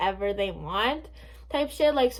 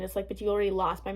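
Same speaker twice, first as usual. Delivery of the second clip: ordinary talk, nothing marked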